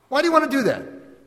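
high, wondering wife; amazed question. Retold or imitated. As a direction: ask it in happy disbelief. Wife asking husband.

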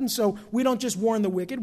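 normal talking voice

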